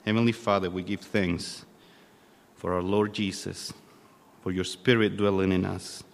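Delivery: normal talking voice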